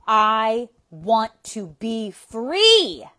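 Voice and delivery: like you mean it. Shown as a demonstration. Coach wanting the listener to mean it, shouting slowly. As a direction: pour your whole rage into "free". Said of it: "say it like you mean it".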